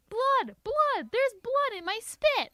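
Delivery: high loud shrieking alarm; girl hollering at pink spit. A narrator's reading. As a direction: screech it at the sight. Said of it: "I hollered some more".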